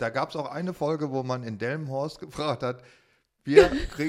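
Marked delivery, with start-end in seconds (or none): 3.4-4.1 laughing. Real, not acted. Laughing mid-story.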